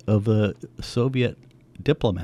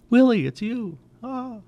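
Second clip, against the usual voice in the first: high, breaking cry of sudden recognition; stunned recognition. Retold or imitated. Old camp friends suddenly recognising Willie, stunned and high. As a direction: gasp it out as the penny drops.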